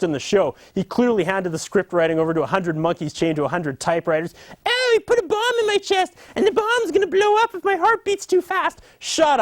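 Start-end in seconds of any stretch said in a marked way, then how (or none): 4.6-9.4 very high, squealing mock panic; hammed-up high-pitched TV melodrama; panicked character parody. Character with a bomb in his chest, high mock panic.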